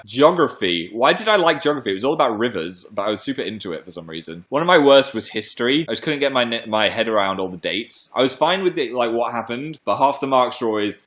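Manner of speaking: normal talking voice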